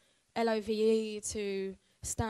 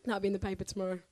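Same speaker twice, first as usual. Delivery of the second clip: usual speaking voice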